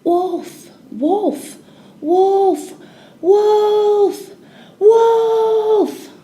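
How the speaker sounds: high, loud prank cry building up; mock alarm shout. A narrator's reading. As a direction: call out like a boy faking danger. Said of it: "and shouting".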